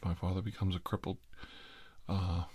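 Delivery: normal talking voice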